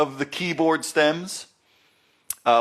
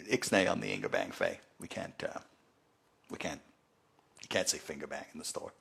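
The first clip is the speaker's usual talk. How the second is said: much lower and softer, nearly a whisper; hushed warning; 10 semitones lower, 14 dB quieter than usual. Retold or imitated. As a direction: tell him off under your breath so the store can't hear. Boss hushes the finger bang joke.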